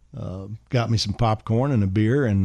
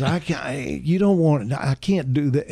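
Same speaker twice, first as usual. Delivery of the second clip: higher-pitched, stammering reluctance; Charlie; 5 semitones higher than usual. Retold or imitated. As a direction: imitate a flustered aide balking. Charlie balks at the order.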